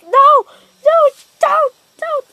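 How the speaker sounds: loud, high begging no, no, don't; pleading shout. Retold or imitated. Captives begging Orochimaru to spare them, shouting. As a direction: yell it out like a captive begging for life.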